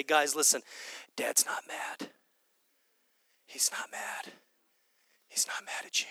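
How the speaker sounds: hushed whisper, gentle and secret. Retold or imitated. Jesus whispering to lost family.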